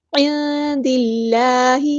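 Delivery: slow, drawn-out Quranic recitation; Tajweed recitation. Shown as a demonstration. Teacher models the verse word, slow Tajweed recitation. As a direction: recite slowly, holding each long vowel. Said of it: "اللہ کا لام باریک پڑھیں گے".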